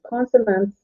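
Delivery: ordinary delivery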